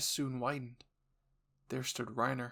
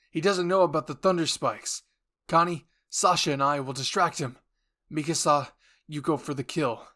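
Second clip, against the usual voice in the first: Jean barking battle plan to the squad, loud; shouted orders; 3 semitones higher, 11 dB louder than usual. Acted. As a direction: command the squad over the noise.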